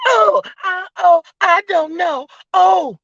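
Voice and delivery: high, slow fake-innocent voice; mock dumb voice. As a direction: play dumb in a high put-on whine. Mocking her playing dumb.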